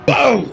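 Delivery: sharp high yelp of pain; pained cry. Acted. Detective yelping as his arm is twisted, a high pained cry.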